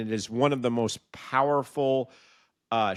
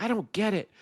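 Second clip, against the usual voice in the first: frustrated disbelief; 6 semitones higher than usual. Retold or imitated. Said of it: "finally he's like".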